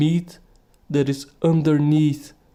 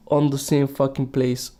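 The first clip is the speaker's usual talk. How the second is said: ordinary talk, nothing marked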